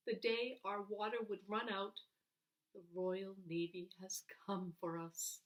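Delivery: ordinary delivery, nothing marked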